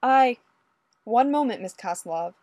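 loud, high, hesitant start; calling back. A narrator's reading. Fraser stalling Olga at the door, calling back. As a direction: call out through a door, stalling. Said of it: "Fraser called back to her".

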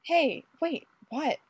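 high, hissed protest; indignant, urgent stage whisper; furious whisper. A narrator's reading. Ray fending off Fraser, furiously whispered. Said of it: "Ray whispered furiously".

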